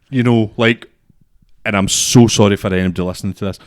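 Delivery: ordinary delivery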